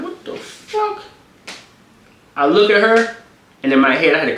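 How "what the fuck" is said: high, slow stunned whimper; stunned disbelief. Retold or imitated. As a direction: react in shocked pain. Shocked after being bitten.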